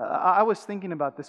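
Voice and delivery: usual speaking voice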